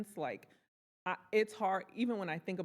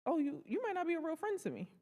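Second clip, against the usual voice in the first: realizing friend is fake; 10 semitones higher than usual. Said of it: "clocking, like".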